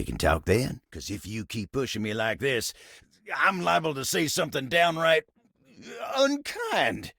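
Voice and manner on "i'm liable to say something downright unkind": high, strained warning with halting pauses; exasperated warning. Acted. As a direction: build to a high burst on "unkind". Warns someone pushing him.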